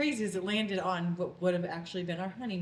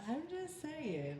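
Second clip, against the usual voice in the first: high, slow and playful; playful teasing; 10 semitones higher, 5 dB quieter, much slower than usual. Real, not acted. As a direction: tease him innocently.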